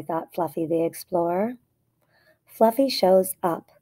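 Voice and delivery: ordinary delivery, nothing marked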